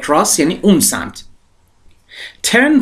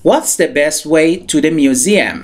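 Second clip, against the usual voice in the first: slow model reading; much slower than usual. Shown as a demonstration. Teacher models English sentence.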